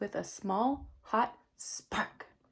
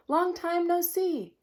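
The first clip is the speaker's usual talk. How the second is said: louder cheery rabbit greeting; friendly rabbit; 8 semitones higher, 4 dB louder than usual. Acted. Rabbit greeting mouse at the den, cheery call. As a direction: greet your old friend brightly. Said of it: "says Rabbit".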